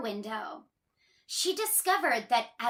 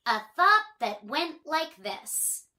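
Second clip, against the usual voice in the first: plain reading voice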